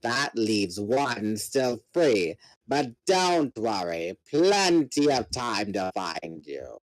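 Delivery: low, slow, menacing alien commander; gloating villain. Acted. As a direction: savour every word like a predator. Voices the villain Visser three.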